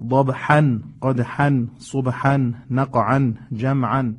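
ضبحا، قدحا، صبحا، نقعا، جمعا each ending in "-an"; fatha tanwin endings. Shown as a demonstration. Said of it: "این هر حرکت تنوین فتحه".

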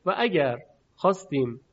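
ordinary delivery

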